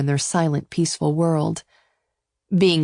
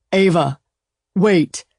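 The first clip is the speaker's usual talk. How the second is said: calling out; 5 dB louder, slower than usual. A narrator's reading. Calling after her as she flees.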